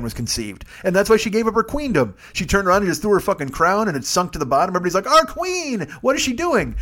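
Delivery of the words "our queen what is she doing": high, shrill-free squeal of alarm, hammed-up; mock shock. Fish crowd watching their queen throw her crown, mock alarmed. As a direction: gasp it out like a panicked crowd.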